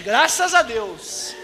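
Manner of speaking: very slow, drawn-out "Graças a Deus"; evasive drawl. Retold or imitated. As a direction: drag it out to avoid answering. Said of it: "E aí, irmão, tá crente?". ("Graças a Deus" bem arrastado)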